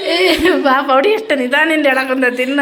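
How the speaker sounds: loud and high, summoning someone; calling out. Real, not acted. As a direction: holler for them to come over. Calling someone over after the call.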